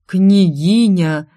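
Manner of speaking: loud, drawn-out astonishment; disbelieving exclamation. A narrator's reading. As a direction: stretch it out, unable to believe your eyes. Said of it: "явно не поверил он своим глазам". (громкое, протяжное изумление)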